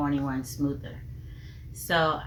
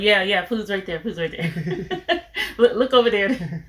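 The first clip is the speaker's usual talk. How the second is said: raised, laughing mock quote; playful, louder impersonation breaking into laughter; laughing imitation; 4 semitones higher, 7 dB louder than usual. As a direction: act it out through giggles. Mimics busy spouse while laughing.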